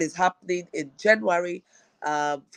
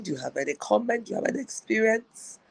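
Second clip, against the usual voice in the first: ordinary delivery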